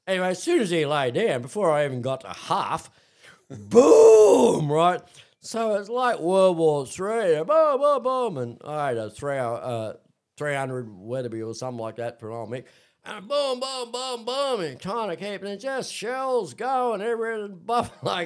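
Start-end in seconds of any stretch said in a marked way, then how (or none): 3.5-4.7 a loud, high, laughing "boom" for the first shot; loud imitated gunshot cut with laughter. Gunfire starting in the story. 7.4-8.0 high, loud "boom, boom" like rifle shots; mock volley of gunshots; imitated gunfire. 13.0-14.2 high "boom" shots fired one after another; mock volley of gunshots; imitated gunfire.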